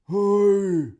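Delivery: high, loud hail from afar; loud far-off shout; distant call. A narrator's reading. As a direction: call across the forest. Troll calls from forest.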